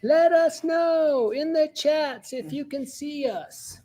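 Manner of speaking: high sing-song call. Acted. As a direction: stretch it out up high, like calling. Repeats the ask to viewers.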